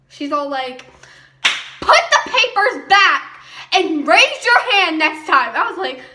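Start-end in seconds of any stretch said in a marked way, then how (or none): 1.8-5.5 loud, slow, high scolding command; mimicking a bossy teacher, slow and loud; stern teacher order. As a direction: lay down the law like a strict substitute. Teacher orders papers returned.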